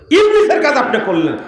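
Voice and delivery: loud, high-pitched accusation; angry rebuke. Real, not acted. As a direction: burst out scolding. Scolding worshippers for bad mosque manners. (ক্রুদ্ধ ধমক)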